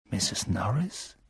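hushed fearful whisper. A narrator's reading. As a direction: peer into the dark whispering. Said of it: "flüsterte Ron".

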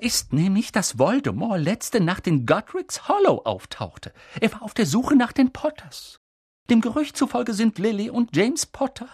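high, urgent report of a rumour; pressing. A narrator's reading. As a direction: push the news out insistently, pitched high. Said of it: "drängte sie weiter". (hoch und eindringlich drängend)

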